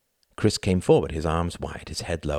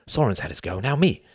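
excited demand, rising high at the end; eager cry; 7 semitones higher than usual. A narrator's reading. Youngest brother demands his turn with Tom, eager cry. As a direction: claim your turn with glee. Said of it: "he cried".

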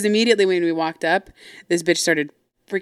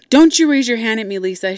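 loud, higher retold reprimand; angry scolding; 4 semitones higher, 7 dB louder than usual. Mom warning daughter who raised her hand, angry scolding. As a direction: imitate a mom laying down the law.

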